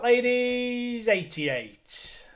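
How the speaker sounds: loud, high bingo number call; bingo caller. Acted. Bingo calling on stream.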